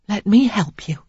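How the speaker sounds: soft whisper; quiet whisper. A narrator's reading. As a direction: whisper it gently to the wounded man. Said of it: "she said quietly".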